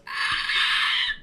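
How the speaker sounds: loud unvoiced screech; death rattle. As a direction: let out a harsh screeching death sound. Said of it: "God, why would you do that?".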